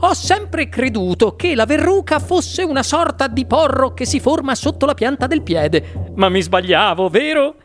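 high, gushing, swooping host voice; effusive Wonka. A narrator's reading. Wonka greeting Veruca and her father, effusive host. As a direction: gush over the guest in high-pitched delight.

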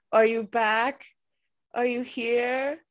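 ordinary talk

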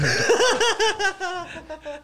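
shrill giggling fit; high burst of laughter. Real, not acted. Friends joking, high laugh.

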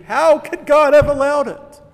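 loud, high protest; indignant outcry. Retold or imitated. An unbeliever protesting the Holocaust, loud and high. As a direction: demand it of heaven, outraged. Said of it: "the next day they say".

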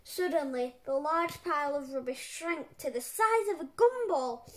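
plain reading voice

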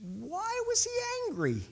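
high, soft puzzled voice; bewildered question. Retold or imitated. Preacher reacting to the puzzling passage, high and bewildered. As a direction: question it in high-pitched disbelief. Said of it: "I read that and I'm like".

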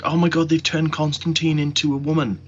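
mocking panicked fans. Mocking fans who are freaking out online, panicked voice. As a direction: parody a shrieking fan. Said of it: "I know people are freaking out".

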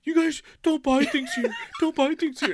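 imitating a tiring ranter; fading yell. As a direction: call it out as he runs out of steam. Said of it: "getting quieter".

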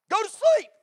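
high, loud mock yell at the kids; yelling parent. Dad mock-yelling at the kids to sleep. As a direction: bark bedtime like a fed-up parent.

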